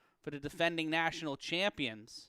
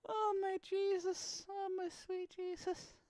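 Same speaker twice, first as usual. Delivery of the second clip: mock Irish mourner, much softer and slowed; playful Irish keening, much quieter; Irish widower; 13 semitones higher, 7 dB quieter than usual. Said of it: "It's got me sounding like an Irish widower".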